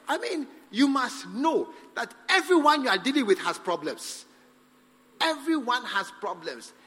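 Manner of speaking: ordinary talk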